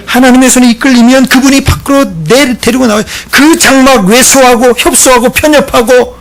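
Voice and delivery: high, loud, driving sermon; full-force preaching. Real, not acted. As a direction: proclaim it to the congregation at full voice.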